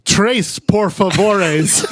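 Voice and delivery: very slow, stretched-out broken Spanish; slow tourist Spanish. Retold or imitated. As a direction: stretch each word like a clueless tourist. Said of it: "I talk slower like it's gonna matter".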